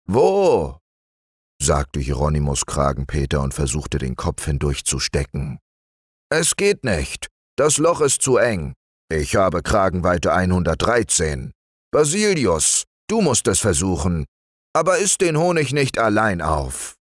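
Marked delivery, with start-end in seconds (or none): 0.1-0.8 high, squeaky "Wo?"; a sudden eager bear yelp; Hieronymus the bear. Acted. Bear cousin perks up at honey news, squeaky. 6.3-17.0 raised, fussy bear voice; Hieronymus the bear. Acted. Hieronymus stuck at the knothole, fussing to Basilius.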